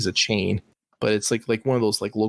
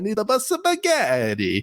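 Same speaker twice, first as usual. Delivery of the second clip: mock Italian plumber voice; 12 semitones higher than usual. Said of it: "Mario and Luigi".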